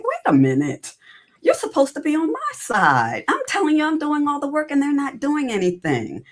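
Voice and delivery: a lightly replayed outburst of hurt pride; indignant protest. Retold protest to her coach.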